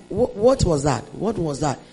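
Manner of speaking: puzzled mother. Retold or imitated.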